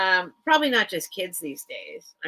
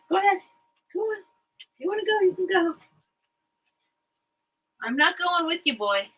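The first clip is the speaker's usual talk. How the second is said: soothing pet talk; 8 semitones higher, faster than usual. Real, not acted. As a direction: coax the dog gently, up high. Soothing her dog to lie down.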